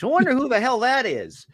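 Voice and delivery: high, loud put-on voice; mock puzzled voice. Actor shows how he used to answer a colleague's call, high and loud. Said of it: "Not the old days".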